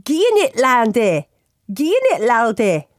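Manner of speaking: hammed-up Scottish slang delivery; mock Scottish accent. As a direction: put on a broad Scots lilt. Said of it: "incredible accent".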